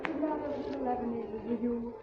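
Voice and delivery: almost too hurt to say it; soft and sad. Acted. Belle to Ebenezer, breaking off their engagement, hushed. Belle sadly parting from Scrooge.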